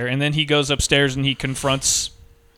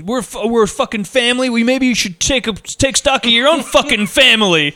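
mock outburst, pitched up; hammed-up, high-pitched indignant rebuke; imitated indignant employee; 7 semitones higher than usual. Fired employee to hotheaded boss, mock indignant. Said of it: "and he goes".

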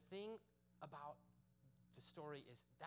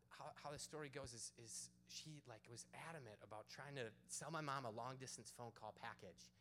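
ordinary talk

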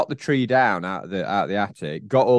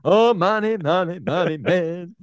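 put-on Jamaican accent; 7 semitones higher, slower than usual. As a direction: sing out money in a Jamaican accent. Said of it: "Think that Jamaican accent".

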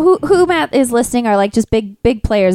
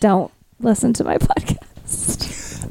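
breathy whisper; 6 dB quieter, much slower than usual. Acted. Mock warning after the joke.

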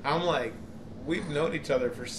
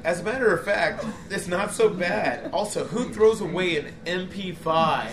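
higher-pitched, animated teasing imitation; mock imitation of friend; 4 semitones higher, 3 dB louder than usual. Imitating a friend who claims it's not so bad, mocking. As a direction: mimic your friend in a raised, lilting voice. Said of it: "you're, you're, you're over here like".

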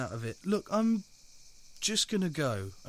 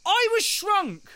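angry shout; 13 semitones higher, 9 dB louder, slower than usual. Acted. Shrunk man shouts back.

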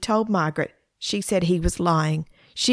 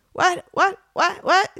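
very high, rapid-fire startled questions; startled awake; 12 semitones higher than usual. A narrator's reading. Stephen woken suddenly by his sister, startled. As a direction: repeat it high, like someone jolted from sleep.

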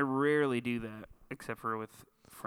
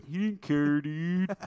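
mock dude voice; 5 semitones higher, 7 dB louder, much slower than usual. Mocking friend's voice, repeated.

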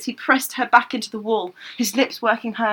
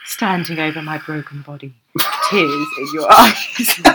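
purr; 6 semitones lower than usual. Acted. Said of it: "I'm trying to purr".

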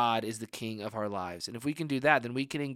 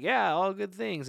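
high, drawn-out mock agreement; put-on higher voice, slow and evasive; unconvincing reassurance; 8 semitones higher, slower than usual. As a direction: stretch it out as if covering up.

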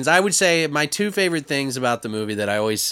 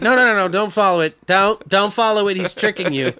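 high, urgent mock warning; 5 semitones higher than usual. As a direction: plead with a friend not to fall for it. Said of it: "he's like".

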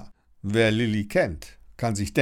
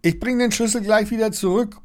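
calling out; 12 semitones higher, 4 dB louder, faster than usual. A narrator's reading. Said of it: "ruft Lilli".

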